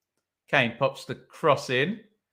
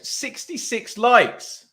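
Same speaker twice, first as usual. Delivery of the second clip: delighted announcement; 11 semitones higher, 4 dB louder, slower than usual. Real, not acted. Excitedly announcing stream like count.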